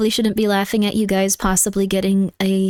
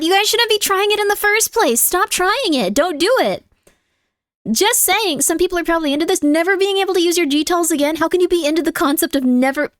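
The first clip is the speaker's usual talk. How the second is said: high scolding; 7 semitones higher than usual. Real, not acted. High-pitched scolding of chat.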